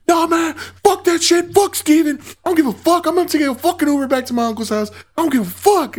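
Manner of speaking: put-on high-pitched irate drunk friend; angry rant. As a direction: rant it high and heated, acting out a fed-up pal. Acting angry friend's refusal.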